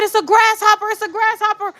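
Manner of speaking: high-pitched, urgent repeated cry; imitating upset son. Upset son telling parent what scared him, high and frantic. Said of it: "by how he was upset".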